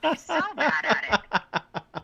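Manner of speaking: laughing. Real, not acted. Laughing at mom's bad lie.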